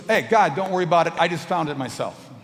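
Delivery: high, loud, cheeky brush-off; breezy dismissal. Retold or imitated. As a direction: wave God away now you found it. Driver brushing off God.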